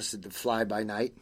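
plain reading voice

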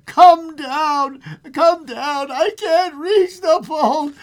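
high, loud desperate call for help; straining cry. Acted. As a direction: shout as if straining to reach. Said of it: "straining and panting".

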